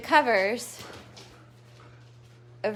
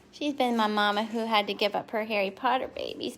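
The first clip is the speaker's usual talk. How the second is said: ordinary delivery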